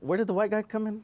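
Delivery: very high, squeaky incredulous question. Acted. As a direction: voice shoots up in disbelief. Actor baffled by new label.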